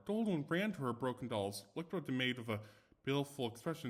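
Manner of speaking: ordinary delivery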